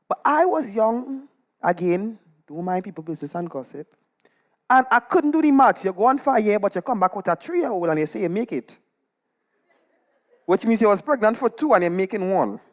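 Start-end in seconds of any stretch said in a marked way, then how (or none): none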